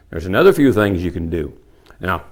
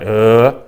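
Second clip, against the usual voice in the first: loud imitated bull grunt; chuckle-starting grunt; 5 dB louder than usual. Shown as a demonstration. Teacher showing how a bull chuckle starts, loud demonstrated grunt. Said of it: "You start".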